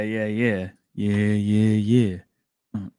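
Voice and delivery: normal talking voice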